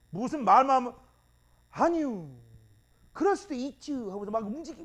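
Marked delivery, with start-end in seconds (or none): 1.7-3.9 dim-witted Chungcheong slowpoke. Acted. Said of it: "미련 곰탱이 같은 애들 느리잖아".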